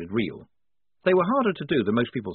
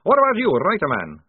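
high, loud snarled demand; snarling; 7 semitones higher, 6 dB louder than usual. A narrator's reading. Maledict turning on the writer, snarling. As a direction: snarl it at a captive. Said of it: "snarled Maledict".

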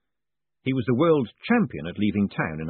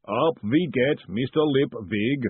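booming golem voice; 3 semitones higher, slower than usual. Acted. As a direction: boom it slowly and patiently. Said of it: "said the booming voice".

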